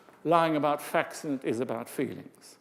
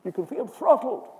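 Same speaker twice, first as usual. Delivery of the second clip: squeak; 8 semitones higher than usual. Shown as a demonstration. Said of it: "when you're tense".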